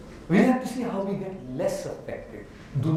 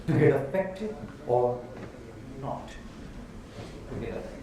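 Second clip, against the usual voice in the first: slow contrast; 6 semitones lower, 4 dB quieter, much slower than usual. Shown as a demonstration. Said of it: "contrast of choices".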